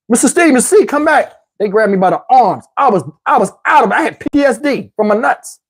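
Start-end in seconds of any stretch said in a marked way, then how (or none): 0.1-1.3 higher-pitched mock calling out; lightly acted urgent summons; calling after someone. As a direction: call a wandering patient back. Staff calling after him, retold.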